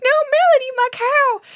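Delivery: high, loud mock-child wail; distressed little girl. Shoved little girl calling after her stolen cow, high mock-child voice. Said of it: "and she's like".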